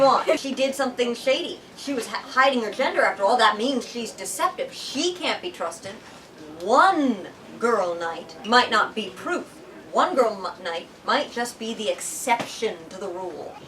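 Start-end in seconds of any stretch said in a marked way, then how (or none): none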